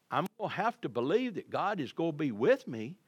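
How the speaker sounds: ordinary talk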